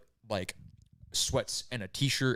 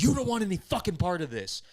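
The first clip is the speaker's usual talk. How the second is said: high, loud snarling threat; a retold killer's roar, higher and louder; menacing threat; 8 semitones higher, 6 dB louder than usual. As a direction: warn him off like a madman mid-attack. Attacker threatening the neighbor.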